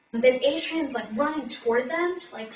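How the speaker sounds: ordinary talk, nothing marked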